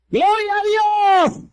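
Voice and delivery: high, drawn-out cry of praise; exultant praise cry. Real, not acted. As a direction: cry out glory, stretched and soaring. (grito de alabanza, agudo y prolongado)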